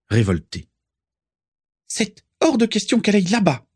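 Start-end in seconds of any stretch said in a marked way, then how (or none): 1.9-3.7 much higher, indignant protest; violent outburst. A narrator's reading. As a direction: flare up in outrage, pitch soaring. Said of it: "s'insurgea violemment Bellatrix". (s'insurger violemment, voix très aiguë)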